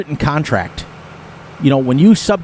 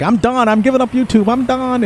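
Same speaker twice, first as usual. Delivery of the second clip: mock fed-up, pitched higher; higher, exasperated giving up; exasperated quitting; 10 semitones higher than usual. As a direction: throw up your hands and quit. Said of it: "And I was like".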